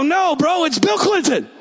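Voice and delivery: high, eager correction; excited retelling of a star-struck moment. Retold or imitated. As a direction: blurt it out, thrilled. Recognizing Clinton in the field.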